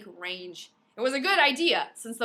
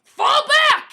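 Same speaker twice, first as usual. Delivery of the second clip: screamed order; 16 dB louder than usual. Acted. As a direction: yell it, much louder and higher. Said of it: "he screamed".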